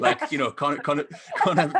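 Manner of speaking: stammering through laughter; words breaking up with laughter; laughing. Real, not acted.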